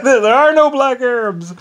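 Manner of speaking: high, loud and stretched out, just after laughing; amused exclamation. Retold or imitated. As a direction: belt it out slowly, fresh from a laugh. Repeats exclaimed retort, laughing around it.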